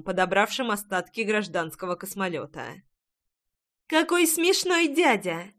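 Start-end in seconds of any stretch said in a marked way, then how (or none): 3.9-5.5 put-on high, slow childlike delight; a small girl amused by a stranger; little girl voice. A narrator's reading. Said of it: "высказалась Ная". (детский голосок)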